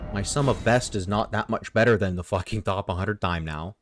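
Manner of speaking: ordinary talk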